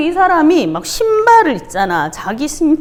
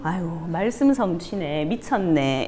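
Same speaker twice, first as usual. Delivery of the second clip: low, soft muttered aside to oneself; inner thought; 7 semitones lower, 8 dB quieter than usual. Retold or imitated. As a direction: voice a private thought, lower and quieter, amused by the end. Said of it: "속으로 뭐라고 생각했을까". (혼잣말하듯 낮고 작게)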